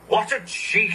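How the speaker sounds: indignant engine splutter; spluttering indignation. A narrator's reading. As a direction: splutter it, offended. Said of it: "he spluttered".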